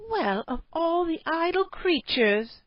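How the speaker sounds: high, slow, outraged cry; scandalised at her lazy husband; indignant exclaim. A narrator's reading. A cave wife finds her husband idle, indignant cry. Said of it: "she exclaimed".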